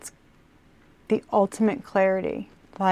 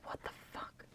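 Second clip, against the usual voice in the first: barely audible mock whisper; whispered wondering; 28 dB quieter, faster than usual. Reader voicing rivals wondering about Scorpio, whispered. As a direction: mouth it almost without voice, as a stunned onlooker.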